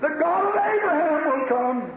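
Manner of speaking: ringing, high-pitched preacher's cry; exultant proclamation. Real, not acted. Sermon's climactic promise to congregation.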